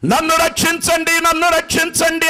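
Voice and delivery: high, desperate mock cry of a drowning man; cry for help. Drowning man crying out to villagers for rescue, high pleading call. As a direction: call out like you are sinking in the pond. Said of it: "కేకలేస్తున్నాడు".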